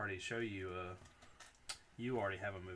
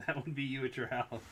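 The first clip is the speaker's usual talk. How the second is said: usual speaking voice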